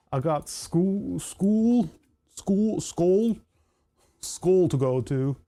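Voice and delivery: badly attempted Delco accent, vowels stretched. Shown as a demonstration. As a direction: have a halting go at a Delco accent. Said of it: "I can't do a Delco accent".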